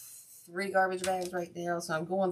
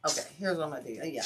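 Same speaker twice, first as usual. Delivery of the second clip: ordinary talk, nothing marked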